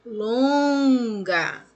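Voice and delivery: LONGA with a long, loud LON; stretched stressed syllable. Shown as a demonstration. Teacher shows pupils the longest syllable, stretched stress. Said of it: "é a mais longa".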